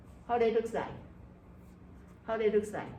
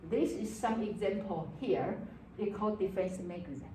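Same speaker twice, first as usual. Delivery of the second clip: usual speaking voice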